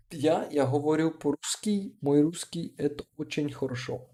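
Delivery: put-on reading Russian aloud with a foreign English accent. Streamer reads a viewer's Russian line aloud, English-accented. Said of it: "pronounced it with an accent too".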